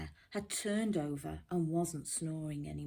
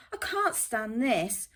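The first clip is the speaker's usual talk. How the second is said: loud, exasperated complaint; fed-up Mister Bear; 5 semitones higher, 7 dB louder than usual. Acted. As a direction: snap it out, fed up with the noise.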